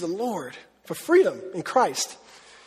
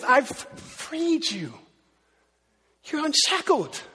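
tender reassurance; 6 semitones higher, much slower than usual. Retold or imitated. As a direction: gently tell them they are free. Jesus speaks to the freed sinner.

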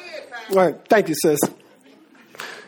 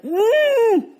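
long, high muffled "mm"; muffled humming; 11 semitones higher than usual. Acted. Preacher plays bound Lazarus waking in the tomb, muffled humming. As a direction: hum as if the mouth is wrapped. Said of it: "You come alive and you like".

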